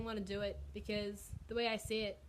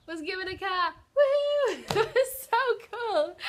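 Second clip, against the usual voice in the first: high, loud whoop of joy; squealing with real delight; thrilled cheer; 9 semitones higher, 11 dB louder, slower than usual. Real, not acted. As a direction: burst out celebrating. Announcing gift of car, thrilled.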